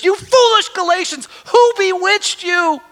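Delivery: furious rebuke. Retold or imitated. Paul scolding the Galatians, high loud outrage. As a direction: let Paul's outrage burst out. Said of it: "he goes off on them".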